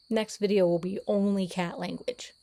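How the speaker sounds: normal talking voice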